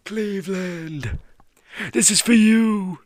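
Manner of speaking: high, slow, tearful cry of triumph; crying out in victory. Retold or imitated. LeBron crying out to Cleveland after the title, high tearful cry. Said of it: "He literally cried out".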